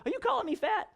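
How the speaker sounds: high, mock-indignant squeal; jokingly outraged, high-pitched; mock offended. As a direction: play the wife pretending to take offense. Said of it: "She'll go".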